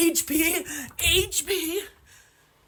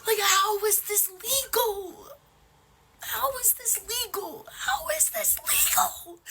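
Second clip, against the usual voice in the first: crying; 3 semitones higher, slower than usual. Real, not acted. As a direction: cry out the question in disbelief. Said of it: "I may be crying right now".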